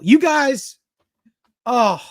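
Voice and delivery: high, loud swell of real emotion; moved by chat welcoming him back, voice lifting high and loud; touched, voice catching. Real, not acted. Streamer overcome as viewers welcome him back, high choked-up cry. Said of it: "I'm trying not to get emotional".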